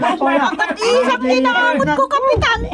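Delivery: put-on shrill old woman crying out that she is being blamed; agitated old woman. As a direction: cry out like an outraged old woman. Old woman tells captain about theft.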